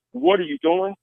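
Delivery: high, tense repeated demand; alarmed cop shout. Retold or imitated. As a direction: snap it twice like a cop with gun drawn. Cop draws gun, retold shouting.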